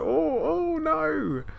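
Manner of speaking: very high, squeaky put-on voice; mock "oh no" imitation. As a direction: imitate it in a thin falsetto. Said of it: "I always think it's like".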